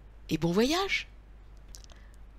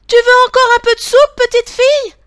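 high, loud, quick tiny-bear shout; shrill call-out as the tiny bear; piercing little bear call; 15 dB louder, faster than usual. A narrator's reading. Tiny bear shouts after the distant girl, piercing high voice. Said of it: "lui crie de sa petite voix perçante".

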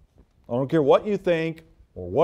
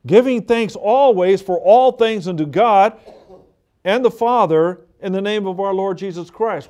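usual speaking voice

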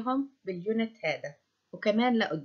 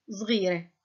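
ordinary talk, nothing marked

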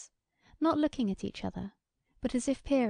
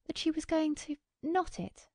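high, flustered, faltering reply; pitched-up unease; flurried, then changing; 8 semitones higher than usual. A narrator's reading. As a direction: answer nervously, catching on the last words. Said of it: "change that came in her voice on that last".

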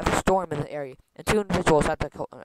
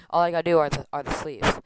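ordinary talk, nothing marked

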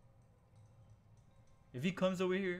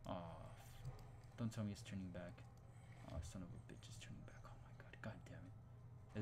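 hushed, low cursing under the breath; dismayed mutter; 6 semitones lower, 13 dB quieter than usual. Real, not acted. Gamer to himself as it's turning back, hushed dismay. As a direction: curse softly, almost whispering. Dreading muttering as the monster returns.

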